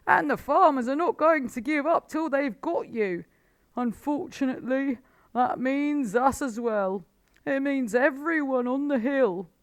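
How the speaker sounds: high, loud bitter complaint; angry Badger. A narrator's reading. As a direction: Badger fuming at the farmers. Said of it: "said Badger furiously".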